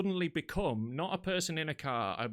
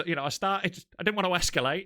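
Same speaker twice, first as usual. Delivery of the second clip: ordinary talk, nothing marked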